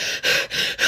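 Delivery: acted out-of-breath panting; quick voiceless gasps for air; imitated heavy breathing. Storyteller showing how winded the chase left them, imitated panting. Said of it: "I'm breathing heavy".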